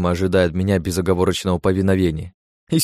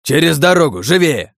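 loud urgent command; shouting; 8 semitones higher, 5 dB louder than usual. A narrator's reading. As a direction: yell over the roar of fire. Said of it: "крикнул мечик, перекрывая шум".

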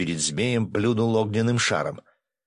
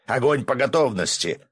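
higher-pitched firing order; command; 6 semitones higher than usual. A narrator's reading. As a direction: order the gunners firmly. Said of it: "скомандовал Виктор Харитонович". (командный окрик)

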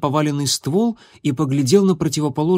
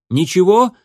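shouted; 6 semitones higher, 5 dB louder than usual. A narrator's reading. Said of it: "крикнул Огнегриф".

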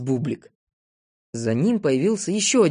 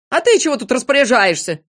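loud, high quarrelling voice; heated, raised-voice objection; indignant challenge; 7 semitones higher, 6 dB louder than usual. A narrator's reading. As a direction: cut in and challenge him. Незнайка butts into the quarrel.

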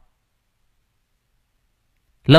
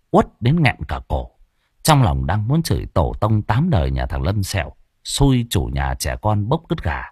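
ordinary delivery

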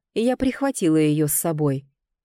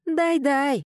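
a high teasing chant; gleeful egging on; 8 semitones higher than usual. A narrator's reading. Sofi eggs her father on, gleeful and high. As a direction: cheer the beating on, delighted. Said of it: "подзадоривала она".

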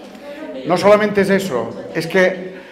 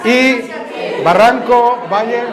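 drawn-out prompt; 5 semitones higher, 3 dB louder, much slower than usual. Shown as a demonstration. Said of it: "¿cómo se dice barranco, valle?".

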